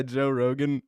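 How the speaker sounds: ordinary talk, nothing marked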